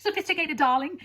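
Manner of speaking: mock-sophisticated British accent, lifting high. Explaining why she wanted a British accent, playful high British voice. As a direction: put on a grand British "darling". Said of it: "I wanted a British accent".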